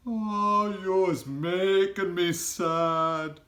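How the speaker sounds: high, loud, slow giant lament; sad giant. A narrator's reading. Giant tells Sophie she makes him sad, slow and high. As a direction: let the giant's sorrow drag each word. BFG voiced by reader.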